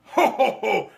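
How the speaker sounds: big booming belly laugh, high and loud; a jolly Santa laugh to open the show. Acted. Santa greets the children with a loud jolly laugh.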